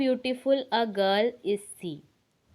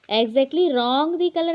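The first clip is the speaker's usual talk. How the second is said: slow, a bit higher and louder; slow wrong example; 4 semitones higher, 6 dB louder, much slower than usual. Shown as a demonstration. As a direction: stress the wrong order slowly. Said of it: "ऐसा sentence नहीं बनता". (गलत क्रम धीरे और ऊँचे स्वर में)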